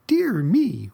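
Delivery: high, loud whine; whining. A narrator's reading. Itchy Aggie complaining to the others, high loud whine. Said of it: "whined Aggie".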